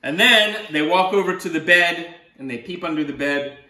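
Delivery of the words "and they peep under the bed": ordinary delivery, nothing marked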